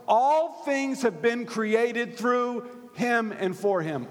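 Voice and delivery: high, emphatic preacher's reading; forceful scripture reading. Acted. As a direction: proclaim the verse, drawing out key words. Reads scripture aloud at full force.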